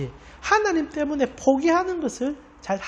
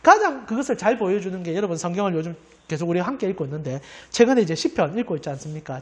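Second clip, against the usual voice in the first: ordinary delivery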